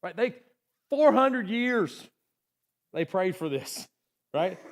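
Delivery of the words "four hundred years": four hundred years, high, loud and slow; four hundred years lifted high and drawn out; emphatic. Acted. Preacher emphasizing the long wait.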